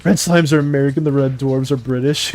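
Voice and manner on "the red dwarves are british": usual speaking voice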